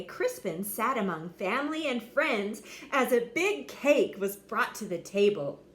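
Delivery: ordinary delivery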